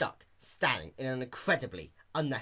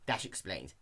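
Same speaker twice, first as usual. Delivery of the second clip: usual speaking voice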